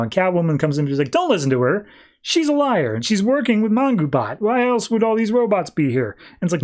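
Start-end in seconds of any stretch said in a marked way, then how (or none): 1.1-6.2 higher, insistent mock accusation; retold accusation. Catwoman warns Superman about Lois, insistent and accusing. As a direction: press the warning urgently in retelling.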